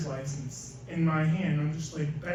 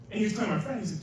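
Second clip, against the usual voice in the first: ordinary talk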